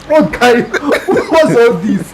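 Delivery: slow, laughing bewilderment; laughing, puzzled; laughing disbelief. Retold or imitated. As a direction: laugh out the question. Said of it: "I'm like".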